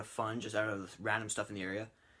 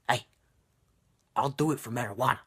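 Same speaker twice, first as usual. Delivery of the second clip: fast, louder, put-on shady street dealer; Boston drug dealer voice; 6 dB louder, faster than usual. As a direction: rattle it off like a dodgy Boston dealer. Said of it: "That's how I would do her voice".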